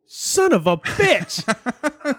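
drawn-out, slow mock-indignant curse; mock outrage. Real, not acted. Left out of the T-shirts, he drags out a mock-outraged curse. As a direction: stretch the curse out in fake outrage.

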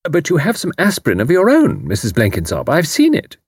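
a sly accusing remark; pointed parting shot. A narrator's reading. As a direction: land the dig sweetly. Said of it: "used her parting shot".

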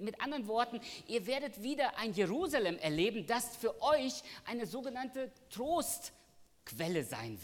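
high, loud preaching voice, driving the promise home; full-force preaching. Acted. Preacher proclaiming God's promise to the church, high and loud. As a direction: preach it out to the congregation.

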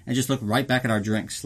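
ordinary talk

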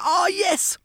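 high mock-excited yes; excited child. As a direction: imitate the thrilled daughter. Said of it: "She's like".

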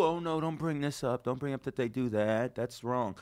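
whisper-ish imitation of nervous people; hushed mock objection.

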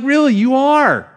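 high, incredulous "Really?"; dad protesting to his child, high and surprised; mock disbelief. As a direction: pitch way up in playful surprise. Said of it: "I'm like".

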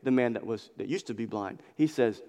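ordinary delivery

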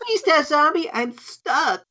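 very high pleading whine; high squeaky plea. Pretend. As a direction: squeak it out like a cartoon. Mock anime plea.

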